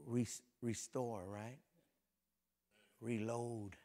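usual speaking voice

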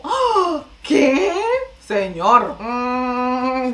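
normal talking voice

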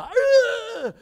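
loud, high straining growl of pain; anguished clenched cry; teeth-clenched groan. Shown as a demonstration. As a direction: demonstrate grinding teeth in agony. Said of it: "tightly, to clench them together".

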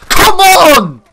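full-volume, high-pitched yell; frustrated shout. Real, not acted. Gamer who keeps getting killed, frustrated shout. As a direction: explode in exasperation, very loud and high.

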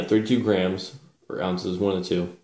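ordinary delivery

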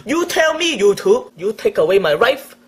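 ordinary talk, nothing marked

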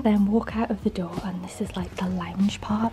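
hushed whisper, trying not to be overheard. Real, not acted. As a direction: whisper quietly through the room. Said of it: "I have to whisper".

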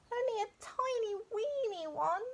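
high small voice of a scared cub; high-pitched timid bear cub; little bear cub. A narrator's reading.

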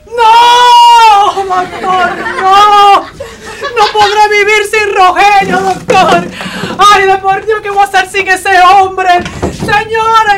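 loud, high melodramatic wailing; hysterical wailing wife. Acted. Wife to doctor, loud high hammed-up wailing. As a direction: howl it like a soap opera widow. Wife hears husband's fate.

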